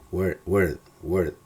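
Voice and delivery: "word" with an E-like vowel, like "werd"; correct pronunciation. Shown as a demonstration. Teacher drills the right vowel, "word" pronounced with E. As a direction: repeat "word" with E in place of O. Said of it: "es E".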